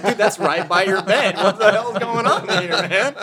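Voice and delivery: high, laughing mock indignation; a friend's fake outrage, half laughing. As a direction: act out the shock through laughter.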